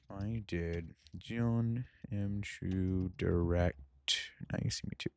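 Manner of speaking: slow, soft, drawn-out words while typing; typing aloud. Real, not acted. Host typing a search aloud, slow and soft. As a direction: murmur it slowly, half to yourself.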